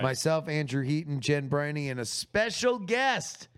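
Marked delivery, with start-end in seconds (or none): 2.0-3.5 special guest lifted high and slowed; teasing reveal. Acted. Teasing the audience about a special guest, slow and high. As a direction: tease the mystery guest.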